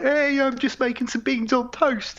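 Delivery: high, breezy soldier voice; cheery soldier. Retold or imitated. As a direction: toss it off like a chirpy squaddie. Voicing a cheery soldier cooking.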